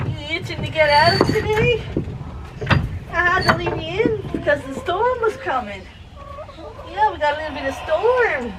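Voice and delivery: high soft voice for the hens; pet talk. Real, not acted. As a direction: coo gently at the chickens. Talking to the chickens.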